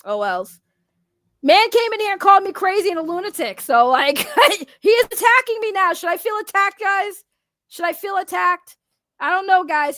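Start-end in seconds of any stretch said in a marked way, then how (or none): 1.4-10.0 mock offended. Mock outrage after being called crazy.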